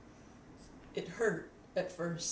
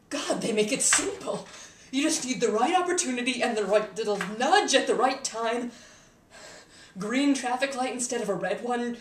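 raised, louder, exasperated rant; worked-up rant; 3 semitones higher, 5 dB louder than usual. Acted. Explaining how simple killing is, worked-up rant. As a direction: list it off forcefully, pitched up. Ranting about how easy it is.

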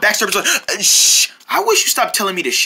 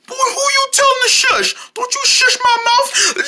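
much higher, indignant yelling; outraged shout; 13 semitones higher than usual. Acted. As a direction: shriek in offended disbelief. Outraged reply to being shushed.

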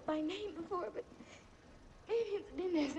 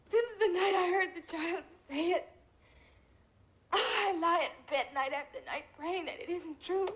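ordinary delivery

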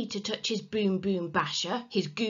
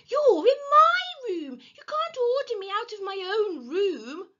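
very high, outraged little-brother voice; indignant protest; 14 semitones higher, 6 dB louder than usual. A narrator's reading. Peter defending his own room, indignant. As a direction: squeal with injured rights. Peter objects to being ordered out.

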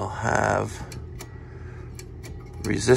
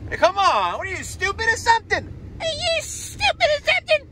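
mock road rage. Yelling at another driver.

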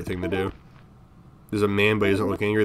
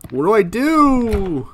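high, loud, drawn-out wail; helpless wail; 12 semitones higher, 6 dB louder, much slower than usual. Real, not acted. Player stuck in the game, helpless wail. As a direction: stretch it into a high, helpless cry. Lost player wailing for help.

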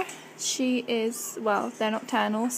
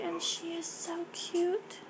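wildlife watching whisper; 5 semitones higher, 7 dB quieter, slower than usual. Real, not acted. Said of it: "I'm being really quiet".

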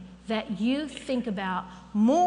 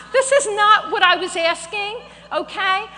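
higher and louder, still laughing; laughing protest; 9 semitones higher, 10 dB louder than usual. Retold or imitated. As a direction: push back at God, laughing.

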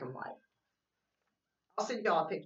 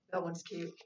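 ordinary delivery, nothing marked

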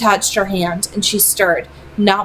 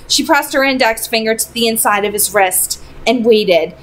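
usual speaking voice

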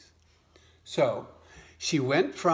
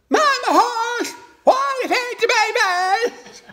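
eager little frog; 8 dB louder than usual. Acted. New frog mother asking mama hen for advice, squeaky high frog voice. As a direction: pipe it up high like a tiny frog. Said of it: "And she says".

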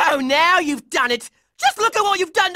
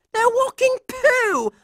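high, incredulous exclamation; disgusted outcry; 6 semitones higher than usual. Acted. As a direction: cry out in comic disgust.

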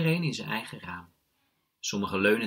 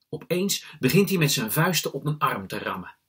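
usual speaking voice

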